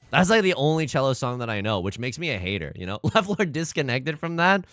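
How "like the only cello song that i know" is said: ordinary talk, nothing marked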